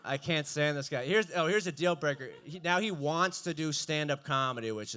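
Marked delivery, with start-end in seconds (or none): none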